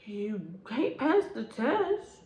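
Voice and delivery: slow, drawn-out mocking put-down; slow taunting jab. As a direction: stretch each word out to sting. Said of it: "I said".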